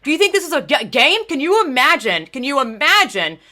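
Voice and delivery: loud, heated and high, working her ass off; a furious athlete telling off the race organizer; retold outrage. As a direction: let the anger boil over as you retell it. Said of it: "And I was like".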